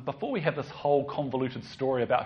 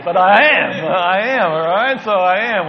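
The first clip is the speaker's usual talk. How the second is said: much louder, emphatic and playful; emphatic insistence; 6 semitones higher, 17 dB louder than usual. Real, not acted. Preacher answering doubting congregation, loud and emphatic. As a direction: insist loudly that you will.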